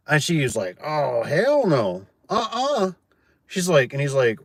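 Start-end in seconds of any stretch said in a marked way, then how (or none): 0.8-2.9 sassy refusal. Retold or imitated. Homeowner refusing installer.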